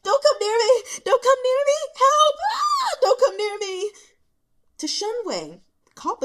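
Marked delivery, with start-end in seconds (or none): 0.0-3.9 high, loud, tearful screaming; panicked crying yell. A narrator's reading. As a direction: shriek in terror through sobs. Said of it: "yelling through the omnipresent snot and tears".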